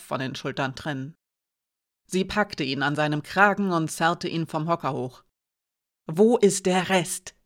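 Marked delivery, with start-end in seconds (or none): 6.1-7.3 slow, menacing demand; threatening hissed question. A narrator's reading. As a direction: hiss it at him, drawing it out. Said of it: "zischte sie".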